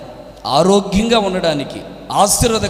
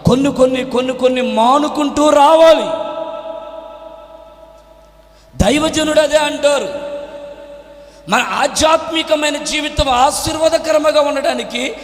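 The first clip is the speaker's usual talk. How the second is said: high, sustained exhortation; impassioned preaching; 9 semitones higher than usual. Real, not acted. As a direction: proclaim insistently, hammering the repeated phrase.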